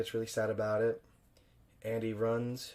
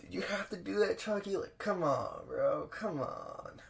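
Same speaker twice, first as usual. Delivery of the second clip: high, pained whining plea; cringing plea; 8 semitones higher, slower than usual. Retold or imitated. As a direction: wince and whine at Chucky to stop. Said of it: "and it's like".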